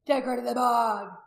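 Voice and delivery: loud, slow commanding shout; forceful, drawn-out command. A narrator's reading. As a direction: shout the order as a chief. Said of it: "Rudd shouted".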